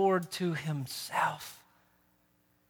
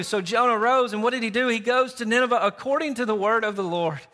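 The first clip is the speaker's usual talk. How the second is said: louder, higher preaching voice driving the story; full-force preaching; 7 semitones higher, 6 dB louder than usual. Real, not acted. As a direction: preach it to the whole church.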